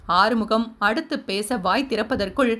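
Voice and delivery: ordinary delivery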